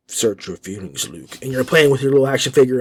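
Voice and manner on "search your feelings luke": low, soft, dark Vader voice; kid's toy Darth Vader. Retold or imitated. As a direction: drop it deep and quiet like Vader. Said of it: "Darth Vader says".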